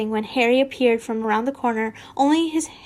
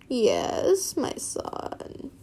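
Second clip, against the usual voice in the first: raspy villain whisper; 5 dB quieter, much slower than usual. Acted. Voldemort answering Draco's call. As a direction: hiss it softly.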